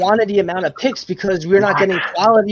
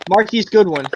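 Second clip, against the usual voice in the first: ordinary delivery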